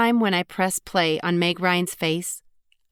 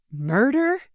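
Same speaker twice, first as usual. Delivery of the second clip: pitched-up, incredulous repeat of Murder?; shocked disbelief; 7 semitones higher than usual. A narrator's reading. Narrator echoing her friend's word, stunned and disbelieving. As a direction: say Murder? like it just sank in. Second take, rising disbelief.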